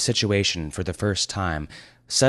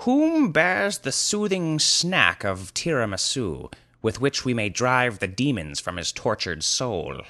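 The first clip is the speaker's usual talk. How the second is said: pompous wizard; 6 semitones higher than usual. Acted. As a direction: intone the question with mock solemnity. Wizard's archaic request.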